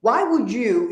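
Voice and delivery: usual speaking voice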